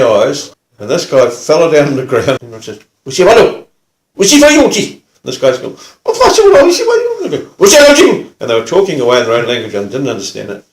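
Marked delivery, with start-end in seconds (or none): 3.0-5.0 loud barked foreign-language order; gruff. Retold or imitated. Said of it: "in a very gruff tone". 6.1-7.4 high, loud, pained jabber; hammed-up cry from a man just hit; rattled protest. Floored man answering back in pain. 7.6-8.3 loud, forceful bark; gruff. Retold or imitated. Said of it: "in a very gruff tone".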